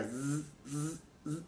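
a soft imitated laser buzz; buzzing laser noise. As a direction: mimic the machine's quiet zzz. (zumbido suave de láser)

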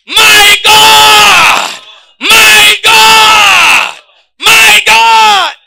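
high, loud ecstatic cry of my God; building preacher's shout; fervent shout. Acted. Preacher shouts repeated exclamation.

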